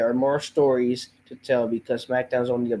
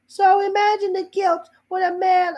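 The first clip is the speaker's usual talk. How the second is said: high mock promo voice of Cody; Cody Rhodes impression. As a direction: quote his promo pitched way up. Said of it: "I'm gonna speak in my normal voice".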